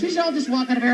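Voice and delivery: ordinary talk, nothing marked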